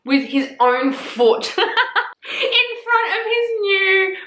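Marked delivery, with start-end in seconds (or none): 1.6-4.2 laughing through the words, slowly; giggling at the gruesome part. Real, not acted. Telling the viewers about the foot scene, laughing.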